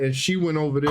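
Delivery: ordinary talk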